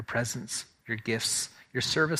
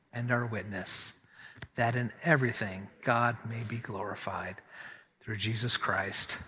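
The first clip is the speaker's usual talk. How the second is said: liturgical reading; 3 semitones lower, 3 dB quieter, slower than usual. Acted. Unison liturgical reading from the screen.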